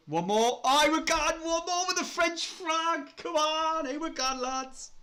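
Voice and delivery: high, loud cry of delight at the new signing; real thrill, voice jumping right up; excited announcement. Real, not acted. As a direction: shout it out like the deal just dropped. New signing announced live on stream.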